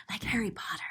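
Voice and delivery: whispered aside; soft unvoiced aside. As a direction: hush it like a secret.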